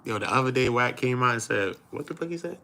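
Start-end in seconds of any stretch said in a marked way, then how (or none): none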